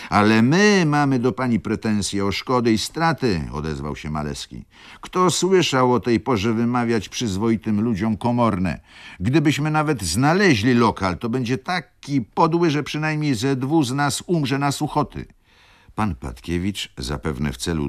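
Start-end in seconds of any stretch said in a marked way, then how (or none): 0.1-3.5 loud, high counterclaim; indignant retort. A narrator's reading. Maleski makes an indignant counterclaim. 5.0-15.3 high-pitched, forceful grumbling at an outrage; indignant protest. A narrator's reading. Maleski keeps protesting at the baroness.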